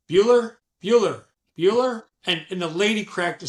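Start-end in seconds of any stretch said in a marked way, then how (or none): 0.1-2.0 higher, louder sing-song roll call; hammed-up roll call to a silent class; teacher roll call. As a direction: call out to an unresponsive room. Roll-call joke on silent customer.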